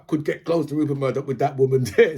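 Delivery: ordinary talk, nothing marked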